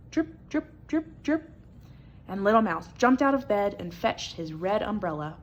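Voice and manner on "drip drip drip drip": high, light drip drip; dripping sound. A narrator's reading. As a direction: drip it like falling water.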